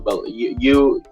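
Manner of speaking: ordinary talk, nothing marked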